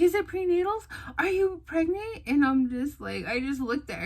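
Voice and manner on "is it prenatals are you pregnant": high, loud, eager questioning; excited guess. Retold or imitated. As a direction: imitate an astonished sister prying. Sister guesses the pregnancy.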